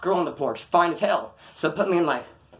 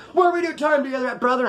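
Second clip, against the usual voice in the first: insistent shout; 8 semitones higher, 3 dB louder than usual. Retold or imitated. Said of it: "big dude asks again loudly".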